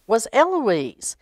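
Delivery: plain reading voice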